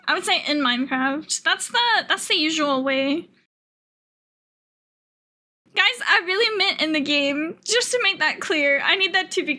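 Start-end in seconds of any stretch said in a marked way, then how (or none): none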